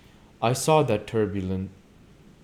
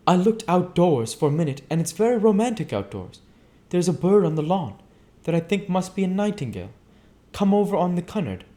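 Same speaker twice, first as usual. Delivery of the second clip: high, louder gushing society lady; Daisy gushing to her dinner guests; 10 semitones higher, 5 dB louder than usual. Acted. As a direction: chatter brightly, a touch breathless. Daisy's chatter.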